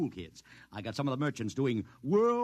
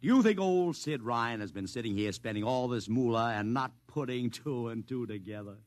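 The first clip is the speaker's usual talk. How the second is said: sly knowing; 6 semitones lower than usual. Acted. Promoter boasting to his assistant, sly and knowing. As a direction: let him in on the secret, pleased with yourself.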